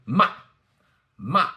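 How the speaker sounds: MA short and loud, falling from high; fourth tone. Shown as a demonstration. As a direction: chop MA down like a karate chop. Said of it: "kind of like a karate chop".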